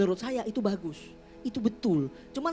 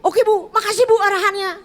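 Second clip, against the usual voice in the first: loud high grateful thanks; excited thanks; 8 semitones higher, 8 dB louder than usual. Retold or imitated. Grateful colleague thanks speaker, loud and high. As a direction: gush your thanks.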